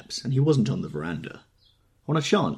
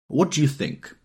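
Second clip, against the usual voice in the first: pushy, raised question; impetuous demand; 8 semitones higher, 4 dB louder than usual. A narrator's reading. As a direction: demand it eagerly, a bit louder. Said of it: "he demanded impetuously".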